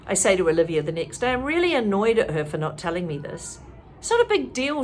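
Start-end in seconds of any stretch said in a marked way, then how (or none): 4.0-4.8 defensive. A narrator's reading. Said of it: "she answers defensively".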